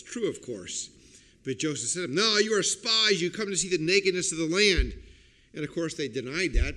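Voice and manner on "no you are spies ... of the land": loud, forceful accusation; raised voice, rushing into the charge; accusing. Acted. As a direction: accuse them as a harsh official. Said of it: "to Joseph's accusation".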